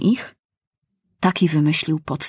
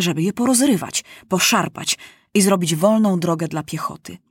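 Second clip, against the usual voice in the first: usual speaking voice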